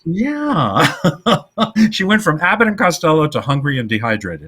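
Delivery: high, giggly punchline; amused and breaking into laughs; joking through laughter. Real, not acted. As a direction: crack up while delivering the joke. Joking amid shared laughing fit.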